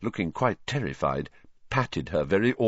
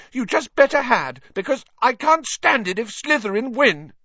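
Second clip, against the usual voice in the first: very high, loud, heated demand; shrill; 5 dB louder than usual. A narrator's reading. Hermione insisting Harry beat Slytherin, shrill. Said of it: "Hermione said shrilly".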